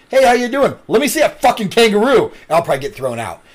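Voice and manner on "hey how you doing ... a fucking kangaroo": pushy cheerful demand. Pretend. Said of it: "be like".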